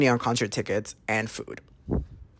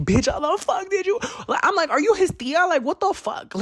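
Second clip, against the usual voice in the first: squeaky, much higher, astonished; stunned disbelief. Retold or imitated. Asking the tarot reader how she knew, high astonished squeal. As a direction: gasp it out high as if she can't believe it.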